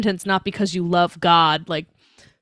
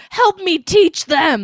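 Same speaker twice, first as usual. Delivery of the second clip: high, loud, drawn-out mock yell; imitated yelling; 7 semitones higher, 5 dB louder, much slower than usual. Imitating a man yelling at a friend on the subway, mock yell. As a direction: yell it slowly, like a subway ranter. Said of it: "he was, like, yelling at her".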